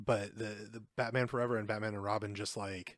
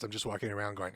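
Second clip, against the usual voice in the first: ordinary talk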